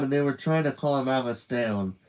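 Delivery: ordinary delivery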